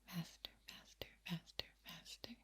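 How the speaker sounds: quick, very soft repetitions. Shown as a demonstration. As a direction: rattle the word off in a hushed voice. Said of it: "makes me speak a little faster".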